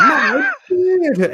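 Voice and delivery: put-on child voice. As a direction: squeak it out like a whiny little kid. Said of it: "This is my impression of a kid with a beard".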